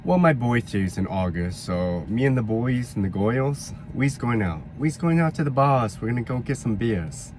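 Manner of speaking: New Yorker inviting the crew out; New York accent. Shown as a demonstration. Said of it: "New York accent".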